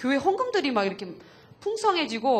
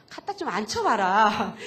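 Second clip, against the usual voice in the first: teasing nudge; 4 dB louder, slower than usual. Retold or imitated. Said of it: "제가 늘 하는 말이 있거든".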